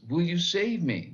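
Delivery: high, loud, desperate question; desperate plea. Retold or imitated. Man hanging from a branch begging God, high and loud. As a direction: beg to be saved. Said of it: "And the man says".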